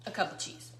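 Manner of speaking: whispered softly to herself; a breathy whispered aside; whispered repeat. Real, not acted.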